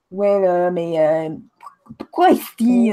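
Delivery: mocking Quebec French drawl. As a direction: imitate local French speech. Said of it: "here it's like".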